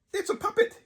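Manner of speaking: high, loud excited burst; a boy's excited cry; exclaiming. A narrator's reading. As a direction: blurt it out with delight. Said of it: "he exclaimed".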